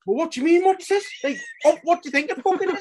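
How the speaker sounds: high-pitched mock outrage; incredulous. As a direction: fire the question back in squeaky disbelief. Acting own indignant reply to character.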